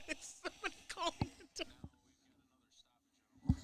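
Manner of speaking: amused, breathy whisper. Real, not acted. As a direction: whisper it with a laugh. Asked again, whispered the second time.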